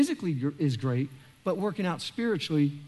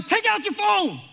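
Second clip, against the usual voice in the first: coach yell; 12 semitones higher than usual. Acted. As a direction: bark it at the whole room like a coach. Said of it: "Today I'm yelling".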